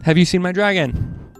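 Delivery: ordinary delivery, nothing marked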